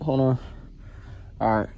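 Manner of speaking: usual speaking voice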